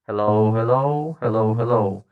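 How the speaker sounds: light karaoke echo; KTV echo. Shown as a demonstration. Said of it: "a light echo".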